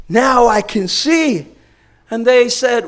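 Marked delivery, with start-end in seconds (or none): 0.1-1.5 slow joyful claim. Retold or imitated. Healed man's joyful claim.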